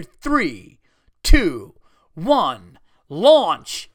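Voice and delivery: loud mock launch countdown, spaced out; called-out countdown. Slide operator about to drop the rider, calling out the countdown. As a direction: punch each count and lift the final command.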